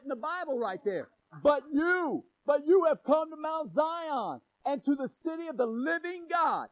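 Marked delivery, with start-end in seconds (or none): 1.4-6.7 loud, higher preaching cry; preaching shout. Real, not acted. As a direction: proclaim the verse at full voice. Preaching the verse at full force.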